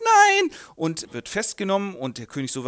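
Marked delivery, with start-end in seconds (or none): 0.0-0.5 high, loud desperate cry. Acted. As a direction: scream it as they grab him. Renald is arrested.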